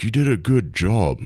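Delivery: slow, low celebrity impression. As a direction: drawl it low and slow, imitating him. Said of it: "that's how he talks".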